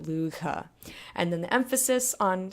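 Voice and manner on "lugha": "Lugha" softly, with a throaty gh; gh in the throat. Shown as a demonstration. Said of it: "here in your throat when you do it".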